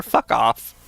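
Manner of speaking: normal talking voice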